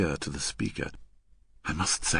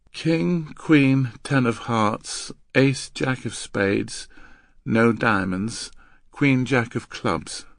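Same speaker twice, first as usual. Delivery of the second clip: slow, higher rote listing; girl reciting; 4 semitones higher, 3 dB louder, slower than usual. Acted. Girl answering the man's code, reciting. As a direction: list the cards slowly and evenly.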